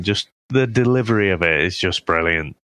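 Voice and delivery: ordinary talk, nothing marked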